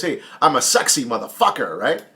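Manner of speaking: ordinary talk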